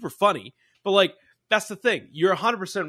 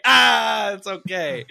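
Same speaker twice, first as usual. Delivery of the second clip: drawn-out Ah, starting high and loud; 4 semitones higher, 4 dB louder, much slower than usual. Acted.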